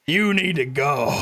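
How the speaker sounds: slow, firm bouncer voice; put-on unhurried tough doorman. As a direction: order him out, drawing the words out. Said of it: "picks up this guy and he's like".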